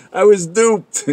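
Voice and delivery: high, loud outburst of realizing they were fooled; mock dismayed exclamation. People at life's end learning it was true, mock dismayed exclamation. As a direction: blurt it loud and high, half laughing. Imagined dead reacting to God's revelation.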